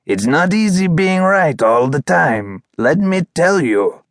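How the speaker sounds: self-important dog character; smug boaster. A narrator's reading. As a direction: brag with mock weariness. Poo Poo boasting of being right.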